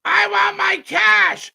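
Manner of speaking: high slow mocking shout; hammed-up wrestler yelling for cash; mock Jericho demand. Streamer mocking a wrestler, hammed-up shout.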